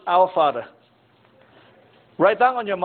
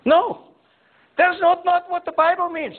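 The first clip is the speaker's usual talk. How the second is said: emphatic high denial; 10 semitones higher than usual. Real, not acted. Firmly rejects the false claim.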